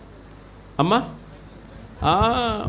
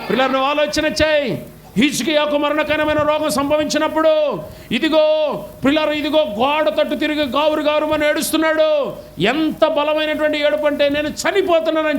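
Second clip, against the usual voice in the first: impassioned preaching; 7 semitones higher than usual. Real, not acted. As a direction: lift the congregation with rising fervour. Preacher rising to climax.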